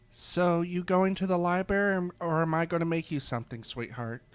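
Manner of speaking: a chirpy creature who finds the question silly; cheerful cat creature. Acted. Cat-eared barista points Mike to the library, high and chirpy.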